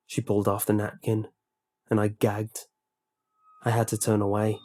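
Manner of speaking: ordinary delivery, nothing marked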